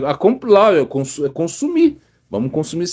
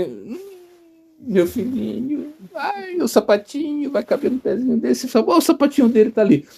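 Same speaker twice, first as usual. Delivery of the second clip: tender mother; 12 semitones higher than usual. Retold or imitated. Mother spots her child's little shoe, cooing tenderly. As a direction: melt as a mother sighing over her baby's shoe. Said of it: "aí ela olha assim".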